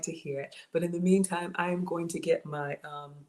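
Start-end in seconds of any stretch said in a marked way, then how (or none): none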